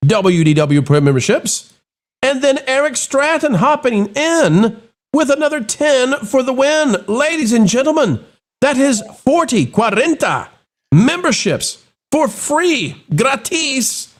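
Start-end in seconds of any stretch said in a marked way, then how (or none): 2.2-14.1 excited announcer. Real, not acted. Hyping gifted memberships on stream.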